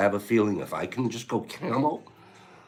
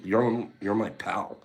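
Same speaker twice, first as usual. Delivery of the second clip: ordinary talk, nothing marked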